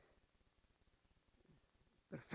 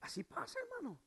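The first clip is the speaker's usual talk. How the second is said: ordinary talk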